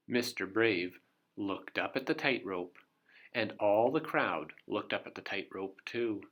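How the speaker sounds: ordinary delivery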